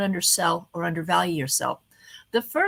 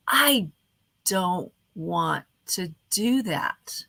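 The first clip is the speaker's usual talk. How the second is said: slow and boring; 2 semitones lower, much slower than usual. Shown as a demonstration. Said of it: "One of them is say".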